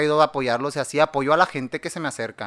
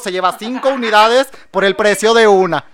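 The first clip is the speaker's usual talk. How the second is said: louder, higher pitch for a deal; mock TV salesman; 6 semitones higher, 13 dB louder than usual. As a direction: sell it like a late-night ad. Mock infomercial selling sanitizer. (vendedor de teletienda exagerado)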